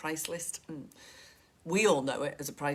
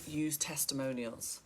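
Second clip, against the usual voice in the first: ordinary talk, nothing marked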